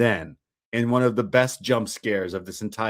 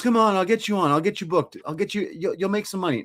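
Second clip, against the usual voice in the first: higher-pitched imitated persuasion; coaxing; 7 semitones higher, faster than usual. Terry offering the wrestler a booking, imitated coaxing. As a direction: imitate cajoling a reluctant friend.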